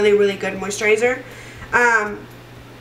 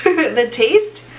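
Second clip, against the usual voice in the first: a little laugh, then the words a touch louder; 3 semitones higher, 4 dB louder than usual. Real, not acted. Laughing at her own stumble to viewers, laughing. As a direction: laugh at your own slip and repeat it.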